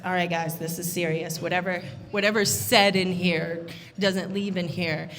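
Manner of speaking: hushed, low, serious MC; real quiet. Retold or imitated. MC telling the room this is serious, hushed and low. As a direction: drop low and soft, slowing for suspense. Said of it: "It got real quiet".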